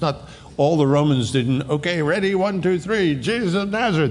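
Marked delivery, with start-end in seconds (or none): 1.7-4.1 mock chorus. Mock chorus of soldiers counting.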